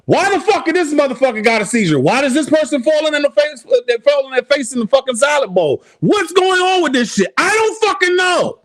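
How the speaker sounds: very high, fast, incredulous yelling; high-pitched disbelieving rant at the TV; exasperated rant. Retold or imitated. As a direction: rage at the screen in disbelief. Raging at TV commercials retold.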